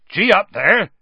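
loud, high driving yell; roaring. A narrator's reading. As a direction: urge the beasts on with a roar. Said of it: "he roared".

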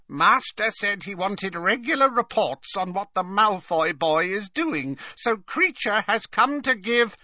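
high-pitched elf servant voice; house-elf Creature. A narrator's reading. House elf reporting to his master, in a high creature voice.

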